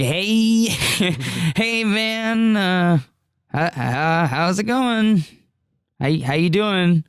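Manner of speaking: high, hesitant, stammered hello; awkward tentative greeting. Retold or imitated. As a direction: retell a gingerly approach to someone upset. Gingerly greeting the sobbing friend.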